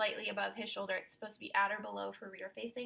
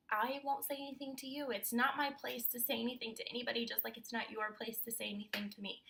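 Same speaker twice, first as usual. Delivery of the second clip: normal talking voice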